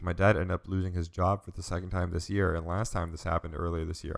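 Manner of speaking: usual speaking voice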